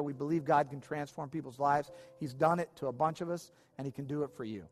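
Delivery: usual speaking voice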